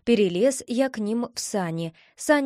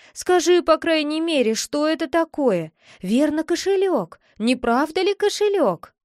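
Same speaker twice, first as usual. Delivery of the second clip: high, louder, insistent guessing; eager coaxing; 6 semitones higher, 3 dB louder than usual. Acted. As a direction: press for the secret, pitched up. Boy presses sister to tell.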